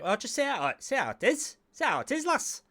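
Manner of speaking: high-pitched, exaggerated Northern lass accent. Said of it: "because she's a Northern lass".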